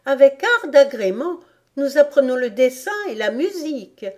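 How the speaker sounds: put-on high, loud little-girl boast; Alice assurée. A narrator's reading. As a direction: rattle off the subjects proudly. (petite fille fière, voix aiguë)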